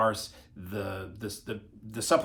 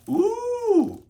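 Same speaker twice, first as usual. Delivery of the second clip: very high, loud drawn-out "ooh"; excited ooh; 7 dB louder than usual. Real, not acted. Vlogger revealing the next item, excited high ooh. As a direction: let out a long, high-pitched coo of delight.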